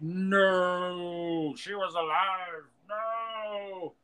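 high, long-drawn hammed-up wail; mock anguished wail. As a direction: stretch it into a melodramatic cry of denial. Mimics the character's anguished cry.

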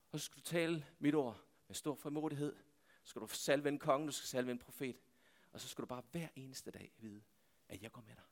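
soft, low voice, fading almost away; hushed divine assurance. Acted. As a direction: let the promise sink into near silence. God speaks in still small voice.

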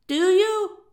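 high, loud demand; sharp shouted challenge; glaring challenge. A narrator's reading. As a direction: fling the question at a crowd. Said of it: "Buck glared at the crowd".

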